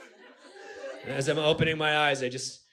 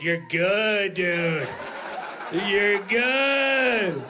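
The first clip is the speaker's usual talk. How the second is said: inner voice pep talk; 3 semitones higher, much slower than usual. Retold or imitated. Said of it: "that little voice".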